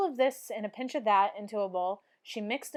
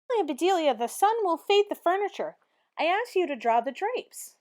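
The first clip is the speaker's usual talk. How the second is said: scolding employer; 7 semitones higher, 4 dB louder than usual. A narrator's reading. Missus Rogers scolding the maid.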